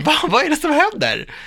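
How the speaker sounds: high, loud startled question; hammed-up bewildered outburst; mock alarm. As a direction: play up the suspicion in a high pitch. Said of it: "jag blir så här".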